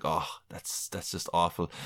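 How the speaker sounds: low, soft mock dismay; muttered aside. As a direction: drop the voice, mock disgust. Retold reaction, quoted self.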